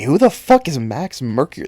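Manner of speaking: now demand it, fed up; angry outburst. Real, not acted. Comic fan ranting at viewers, exasperated shout. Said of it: "that small outburst".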